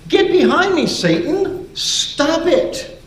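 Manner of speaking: loud, high-pitched exasperated command; acted outburst, voice shooting up; angry rebuke. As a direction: cry out a fierce rebuke. Jesus rebukes Peter.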